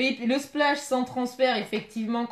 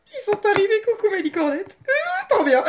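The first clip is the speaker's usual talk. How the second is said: much higher, louder excited squeal; squealing with joy; 11 semitones higher, 4 dB louder than usual. Real, not acted. Vlogger squealing to a viewer that the package came. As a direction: shriek with delight at the arrival.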